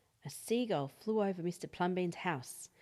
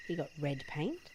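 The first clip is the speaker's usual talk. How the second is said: ordinary delivery, nothing marked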